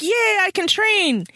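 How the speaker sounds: high, slow chirpy mock glee; mock cheerful. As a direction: play Diana oblivious and chipper. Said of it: "she's like".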